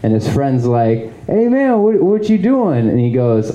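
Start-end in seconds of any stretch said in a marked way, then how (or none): 1.3-2.9 high-pitched questioning buddy; imitating a bewildered pal, pitched way up; puzzled friend. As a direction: call after a friend in a raised voice. Voicing the seller's puzzled friend.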